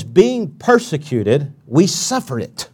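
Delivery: slow set-up, then SUFFER hit firmly; stress on suffer. Shown as a demonstration. Preacher stressing the response to persecution, stress on suffer. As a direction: land the emphasis on suffer, the response. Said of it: "the emphasis is on their response".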